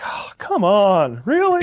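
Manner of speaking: a put-on whiny hero voice; stiff hero. Mocking the hero's acting, slow whiny disbelief. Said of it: "that's how he acts the whole movie".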